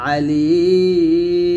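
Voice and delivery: drawn-out chanted Alim, long madd held before a silent ম; Quran recitation. Shown as a demonstration. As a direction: stretch Alim as in tilawat, stopping on ম. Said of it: "তারপর আছে আলিমুন". (টেনে টেনে তেলাওয়াতের সুরে আলিম)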